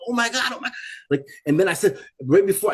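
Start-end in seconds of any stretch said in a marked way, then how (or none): 0.1-0.7 high-pitched frantic exclamation; retold panic. Retelling his panic at the pit.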